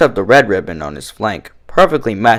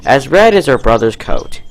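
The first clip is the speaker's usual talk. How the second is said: plain reading voice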